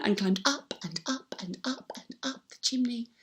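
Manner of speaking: breathy unvoiced whisper. Telling children how the elves sneak up the chimney, in a whisper. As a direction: whisper it as they slip away.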